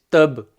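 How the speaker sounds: tab with the b released in a bounce; tab, kalkala bounce on the b; bounced ba. Shown as a demonstration. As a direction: let the b in tab bounce as you stop. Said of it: "you always bounce them when you're stopping on them".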